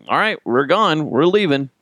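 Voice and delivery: re-enacted loud parental call; firm parent. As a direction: declare the outing over as a fed-up parent. Acted parent hauling off child.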